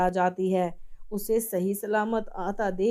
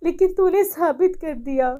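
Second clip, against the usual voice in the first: remorseful pleading; 6 semitones higher, 6 dB louder than usual. Acted. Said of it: "आंखें भर आती हैं".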